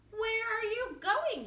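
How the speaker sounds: high, loud shout. A narrator's reading. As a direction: call out to the runaway. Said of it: "shouted the farmers".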